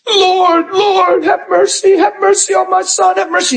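beg, each mercy pressed harder; pleading mother. Acted. Mother running after Jesus, pleading.

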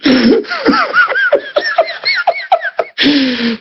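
very high mock sobbing; put-on high-pitched crying noise; imitated bawling. Husband and wife bawling together, imitated high sobs. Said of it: "She starts bawling".

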